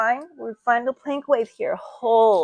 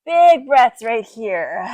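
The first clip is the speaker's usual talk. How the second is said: slow drawn-out cue; 9 dB louder, much slower than usual. Shown as a demonstration. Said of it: "yoga breathing cue".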